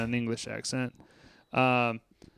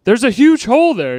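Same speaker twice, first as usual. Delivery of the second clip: much higher, louder mock outrage; quoting David; 10 semitones higher, 9 dB louder than usual. David pointing out the broken window, higher and louder. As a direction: exclaim it in a high huff. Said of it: "he was like".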